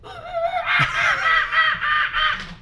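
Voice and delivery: high wild jungle screech; jungle impression. Shown as a demonstration. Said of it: "my best jungle impression".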